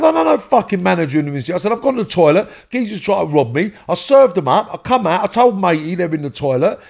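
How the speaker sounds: high, fast, heated explanation of the attack; worked-up retold protest. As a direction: rattle it off, agitated and indignant.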